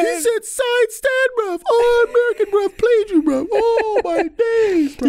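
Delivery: very high, stretched-out jeering; heckling street boys. Retold or imitated. Boys ribbing their friend told off by an American, high jeering. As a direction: crow over the friend's humiliation. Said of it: "their friends was like".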